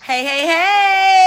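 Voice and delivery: high, slow sing-song hey; excited greeting. Real, not acted. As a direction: greet him brightly, drawn out.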